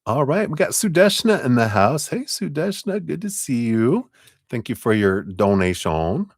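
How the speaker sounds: lightly put-on French accent, bright and up high; bad French accent. A streamer greets a donor, in a bad French accent. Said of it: "a very bad French accent".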